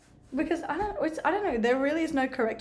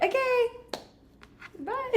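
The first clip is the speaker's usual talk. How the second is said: awkward goodbye; 12 semitones higher, 4 dB quieter, much slower than usual. Retold or imitated. Awkward conversation ender.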